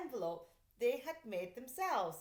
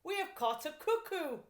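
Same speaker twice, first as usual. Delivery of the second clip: higher, louder pleased policeman; smug policeman; 7 semitones higher, 6 dB louder than usual. A narrator's reading. Policeman reporting his catch, higher and louder. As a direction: announce the catch proudly. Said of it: "said the second policeman".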